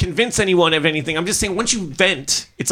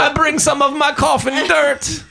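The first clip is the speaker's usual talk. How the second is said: much higher, laughing Italian vampire voice; Italian Dracula; 8 semitones higher than usual. Pretend. Joking about vampires with friends, a hammed-up Italian Dracula. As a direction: ham up a Dracula bringing his coffin dirt. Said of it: "Now I'm Italian Dracula".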